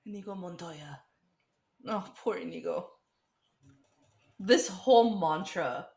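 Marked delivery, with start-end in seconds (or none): 0.1-1.0 breathy whispered name. Pretend. Whispering the hero's name to her friend. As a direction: hush the name like a secret.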